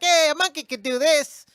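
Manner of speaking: high, loud mock-casual voice; casual brush-off. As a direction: shrug it off, big and silly. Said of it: "he brushes it off like".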